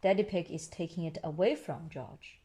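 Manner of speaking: ordinary delivery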